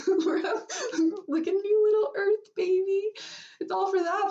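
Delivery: high, tearful voice breaking into laughs; real tears mixed with giggles; crying through laughter. Real, not acted. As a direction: cry and laugh at once, pitch way up. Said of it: "I said I wasn't gonna cry".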